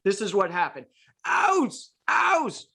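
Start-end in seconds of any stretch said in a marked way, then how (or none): 1.3-2.7 high, pained mock whimper of "ows". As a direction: imitate the deflated handler's hurt whine. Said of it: "this is what the guy's body language looked like".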